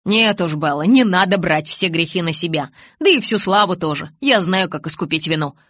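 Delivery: a hint of cocky Jacob; young man voice. Jacob answers Bella.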